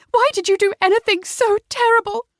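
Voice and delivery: high, tearful woman's voice; through a sob. Acted. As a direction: accuse him through tears. Sobbing woman accuses her husband.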